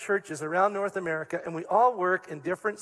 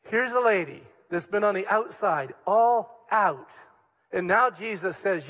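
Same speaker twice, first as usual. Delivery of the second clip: ordinary talk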